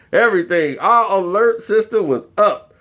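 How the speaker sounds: ordinary talk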